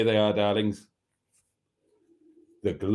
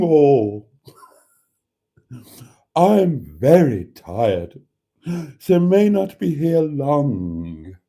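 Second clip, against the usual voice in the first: slow, weary, drawn-out read with a crying edge; tired voice; 6 dB louder, slower than usual. Shown as a demonstration. Redoing a viewer's greeting in an exaggerated tired, slow voice. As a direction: drag every word out like you're utterly exhausted. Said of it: "I should have said that in a more tired voice".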